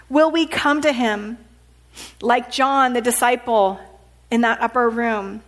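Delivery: ordinary talk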